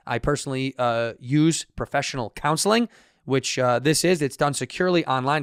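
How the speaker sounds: upbeat sponsor read. Acted. Host reading the Stitch Fix ad, upbeat. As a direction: sell it like a host ad.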